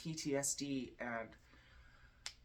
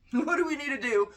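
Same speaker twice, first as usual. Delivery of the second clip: much higher and louder, mock-dramatic plea; dramatic repeated question; 10 semitones higher, 9 dB louder than usual. Shown as a demonstration. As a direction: ask the cards in hammed-up alarm. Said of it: "same question again".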